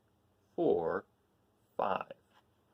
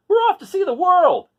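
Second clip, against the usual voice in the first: much higher, louder eager rooster; rooster's voice; 15 dB louder than usual. Acted. Rooster asking the cats to travel, high eager call. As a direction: chirp the invitation up high like a cocky rooster.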